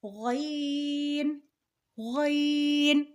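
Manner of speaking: غین with heavy throaty gh, drawn out; thick pronunciation. Shown as a demonstration. Teacher modelling for learners, غین thick and long. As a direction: round the start of غین and stretch it. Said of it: "غین موٹا پڑھا جاتا ہے".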